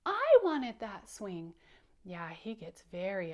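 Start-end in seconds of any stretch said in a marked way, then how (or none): 0.0-1.5 loud, slow mock wail; crying pout. Shown as a demonstration. Teacher leading children in copying Powder, loud crying pout. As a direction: drag it out loudly like an upset little bug. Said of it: "Can you say that like him?".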